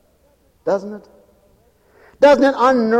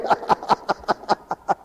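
breathy laugh; 7 dB quieter than usual. Real, not acted.

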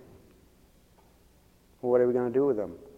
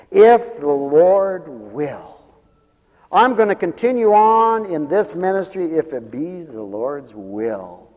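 loud, high, ringing declaration; raised pulpit voice, hammering key words; full-force preaching; 4 semitones higher, 8 dB louder, much slower than usual. Real, not acted. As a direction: preach it out forcefully to the whole congregation.

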